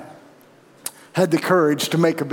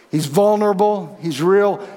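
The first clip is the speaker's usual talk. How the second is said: normal talking voice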